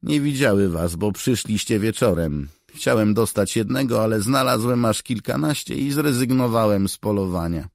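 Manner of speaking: a lively soldier telling his news; character voice. Acted. Character quote.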